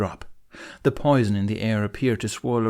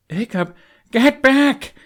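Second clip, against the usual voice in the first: much higher, much louder, slow urgent yell; shout; 10 dB louder, slower than usual. A narrator's reading. Hiccup warning Astrid again, urgent shout. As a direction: drag out the warning so it carries. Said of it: "Hiccup shouted again".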